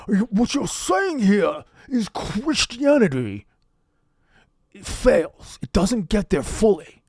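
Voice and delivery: high, drawn-out senator impression; Bernie Sanders. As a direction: declaim it like a stump-speech politician. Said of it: "your impression is".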